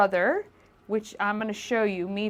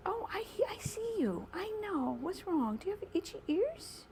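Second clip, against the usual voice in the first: much higher and softer cooing to a hiding cat; pet talk voice; 12 semitones higher, 9 dB quieter than usual. Real, not acted. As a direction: gently coax the cat under the chair. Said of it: "my, uh, pet talk voice".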